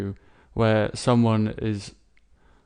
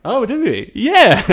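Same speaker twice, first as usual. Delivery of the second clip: much higher and louder, thrilled; delighted surprise; 13 semitones higher, 10 dB louder than usual. Real, not acted. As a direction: burst out, amazed they agree. Delighted they agree on rating.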